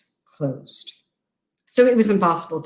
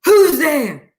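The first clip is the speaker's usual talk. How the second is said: high, loud startled call; the old man calls out in alarm; old man crying out from his bed in the dark; frightened cry; 8 semitones higher, 5 dB louder than usual. Acted. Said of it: "crying out".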